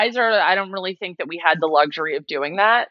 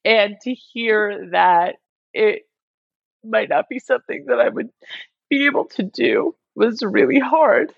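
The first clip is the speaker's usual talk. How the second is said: choked up mid-sentence, higher and strained; getting emotional; 3 semitones higher than usual. Real, not acted. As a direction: hold back tears as you say it. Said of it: "sorry, I'm getting emotional".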